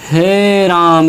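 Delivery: हे राम stretched very long and slow, three matra length; prolonged pluta vowel; drawn-out he Ram. Shown as a demonstration. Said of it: "जैसे मैंने बोला".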